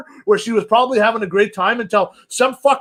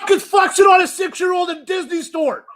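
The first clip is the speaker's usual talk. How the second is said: furious outburst, pitched way up; angry shout; 10 semitones higher, 3 dB louder than usual. Real, not acted. Ranting to cohost about Disney store staff, furious shout. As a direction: yell it in outrage.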